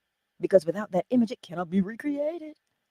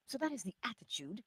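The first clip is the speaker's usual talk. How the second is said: ordinary talk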